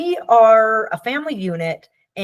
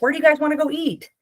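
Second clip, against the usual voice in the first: eager planner; 9 semitones higher, faster than usual. Retold or imitated. Planner friend asks the group.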